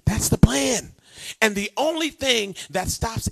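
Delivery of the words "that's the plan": whisper. Acted. Hushed closing line.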